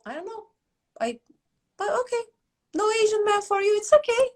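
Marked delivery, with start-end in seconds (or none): none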